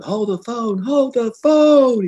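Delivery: dad bellowing high from the ambulance, drawn out; imitated shout. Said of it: "He's shouting".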